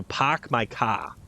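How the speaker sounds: slow Massachusetts "pahk my cah"; Massachusetts accent. Shown as a demonstration. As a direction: drop the R's like a New Englander, Massachusetts style. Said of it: "they don't pronounce their R's".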